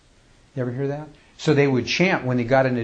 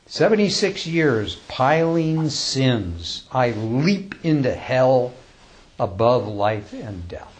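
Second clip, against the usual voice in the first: slow, measured recitation with long pauses; slow, reverent poem recital; poem reading; 4 dB louder, much slower than usual. Acted. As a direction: read it like a sacred verse, unhurried. Recites another death poem.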